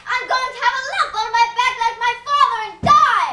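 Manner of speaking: loud, frantic wail about dying; panicked scream. Acted. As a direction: scream in hysterical fear. Colin's hysterical tantrum continues.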